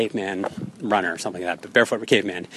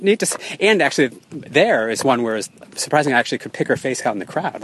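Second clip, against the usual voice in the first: normal talking voice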